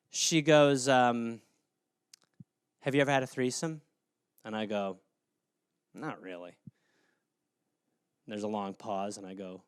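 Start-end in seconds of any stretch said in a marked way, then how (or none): 2.8-9.6 hushed. Retold or imitated. Retelling awkward bedroom talk.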